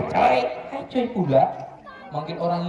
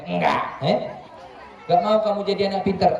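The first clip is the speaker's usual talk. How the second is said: ordinary delivery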